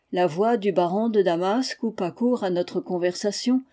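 ordinary delivery, nothing marked

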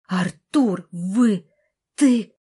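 outraged sputtering shout; furious outcry. A narrator's reading. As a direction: sputter with rage. Furious tug-of-war over the blanket.

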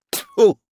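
high, loud disgusted spit sound; spitting tfu. A narrator's reading. Aunt spits in disgust at nephew's folly. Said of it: "Тётушка даже сплюнула".

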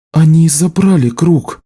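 slow, high, stunned words; faltering. A narrator's reading. Healer tells of the stolen circle, slow and faltering. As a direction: let it out slowly, in shock. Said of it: "запинаясь, проговорила она".

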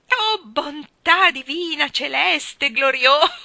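loud, high, slow exclaiming into laughter; hearty laughing roar. Acted. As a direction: roar it out while wiping tears. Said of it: "ruggì mister Wigg".

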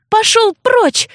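high squeak, slow and trying to sound fierce; squeaky shout. Acted. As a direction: squeak it out like a tiny brave creature. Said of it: "пискнула я".

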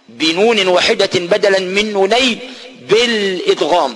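ordinary delivery

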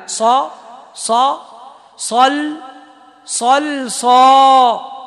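صا and صل drawn out, last صا long and loud; heavy sad drill. Shown as a demonstration. Teacher drilling heavy sad syllables, ending loud. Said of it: "فخم اللام".

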